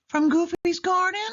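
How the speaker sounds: very high squeaky mouse voice, playful; high giggly guess; giggly Minnie. A narrator's reading. Minnie guesses with Mickey, high giggly mouse voice. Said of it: "Minnie giggled".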